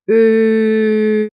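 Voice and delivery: long steady "Ö", lips as for O; drawn-out "Ö", rounded lips over an E sound; held German Ö. Shown as a demonstration. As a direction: sustain "Ö" evenly for a learner. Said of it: "and you get it".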